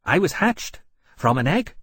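bright, high little-dragon voice. A narrator's reading. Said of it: "in a bright voice".

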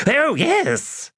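very high, eager squeal; eager cry. Acted. Magus butting into the women's talk, very high and eager. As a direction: leap in with delight. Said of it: "cried Iskaral Pust".

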